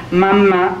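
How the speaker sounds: mechanical doll voice; creaky robot voice. Acted. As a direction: voice it like a wind-up doll. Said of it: "whirred and whirled and went".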